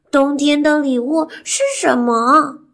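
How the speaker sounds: high and loud, rising question; to someone hard of hearing. Acted. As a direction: shout the question up to granny. Fox shouting to deaf granny.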